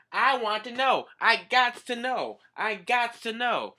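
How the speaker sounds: high, loud frantic repeated demand. Real, not acted. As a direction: demand an answer, worked up. Demanding to know father.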